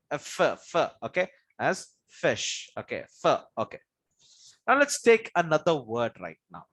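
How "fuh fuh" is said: "f, f" as two short fuh sounds; letter sound. Shown as a demonstration. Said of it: "is pronounced as what?".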